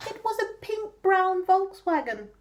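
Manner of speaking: usual speaking voice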